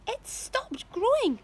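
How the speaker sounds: high, slow call; Spiker crying out. A narrator's reading. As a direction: announce it in a high voice. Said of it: "Aunt Spiker cried".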